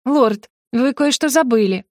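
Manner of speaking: кокетливо, повыше; изобрази невинную застенчивость перед мужем; наигранное смущение. Acted. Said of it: "в наигранном смущении". (притворно смущённо)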